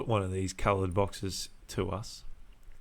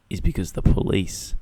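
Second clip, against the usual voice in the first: ordinary talk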